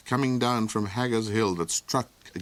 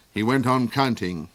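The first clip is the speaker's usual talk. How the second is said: usual speaking voice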